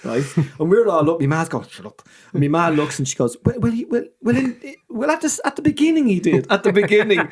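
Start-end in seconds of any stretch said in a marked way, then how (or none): 1.7-2.0 sharp whispered shut up; whispered warning from mum in church; hissed hush. Retold or imitated. Mum hushes giggling kids. 3.4-7.2 flustered stammer. Retold or imitated. Mum flustered, covering for dad.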